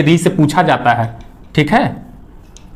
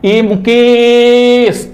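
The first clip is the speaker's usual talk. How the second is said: drawn-out call, higher and louder, Mukesh stretched; calling from afar; 6 semitones higher, 3 dB louder, much slower than usual. Shown as a demonstration. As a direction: call out to someone far away. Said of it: "जैसे कोई दूर है उसको बुला रहे हैं". (दूर खड़े मुकेश को लंबी आवाज़ में पुकारना)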